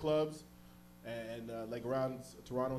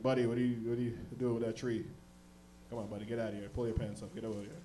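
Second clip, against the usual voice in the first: low cop voice; 4 semitones lower than usual. Retold or imitated. Cops addressing him.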